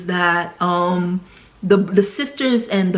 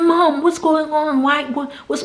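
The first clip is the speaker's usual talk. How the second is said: panicked pleading; 7 semitones higher than usual. Retold or imitated. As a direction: beg in panic, voice up high. Sister panics over choking mother.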